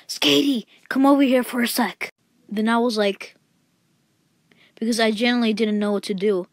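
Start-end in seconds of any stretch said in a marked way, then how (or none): 0.1-2.1 higher, louder teacher call across class. Retold or imitated. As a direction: call the kid over like a teacher. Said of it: "then my teacher would call me".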